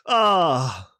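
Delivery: usual speaking voice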